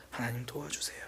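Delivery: very soft, near-whispered cry for help; hushed plea. Acted. Someone unable to help themselves asking God, barely a whisper. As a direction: let it fall to a breath. Voicing prayer for rescue.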